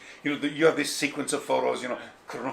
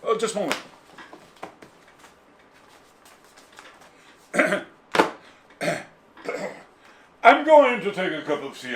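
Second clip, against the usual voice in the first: higher, louder put-on shopkeeper voice; imitating Takeshi; 7 semitones higher, 7 dB louder than usual. Takeshi excusing himself to the visitor, higher put-on voice. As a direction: make a show of excusing yourself for tea. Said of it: "he'll go like this, he'll go".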